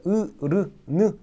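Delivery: 으, 르, 느 each on a bare eu vowel; sukun sound. Shown as a demonstration. Teacher demonstrates sukun, 으, 르, 느 with an eu sound. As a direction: say 으, 르, 느 as separate syllables with eu. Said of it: "으 발음이 난다는 거죠".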